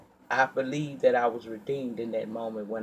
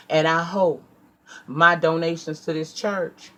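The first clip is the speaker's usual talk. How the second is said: louder, furious send-off; heated rant; 3 semitones higher, 5 dB louder than usual. Retold or imitated. Woman cursing the church leaders on leaving, louder and furious. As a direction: let the anger boil over. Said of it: "Yes, bitch, I'm heated now".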